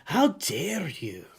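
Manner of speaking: slow, indignant Scottish lord impression. Streamer quoting a Last Crusade scene, mock Scottish lord. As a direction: draw it out like an affronted Scottish lord. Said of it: "he's acting like a Scottish lord".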